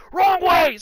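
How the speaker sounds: high, loud shout of alarm; dad yells. Retold or imitated. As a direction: shout it across the street. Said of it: "and he yells".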